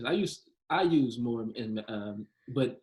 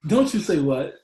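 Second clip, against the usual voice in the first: louder, sharp mock parent scold; mock stern scolding; 4 semitones higher, 9 dB louder than usual. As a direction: play the stern mom telling a kid off.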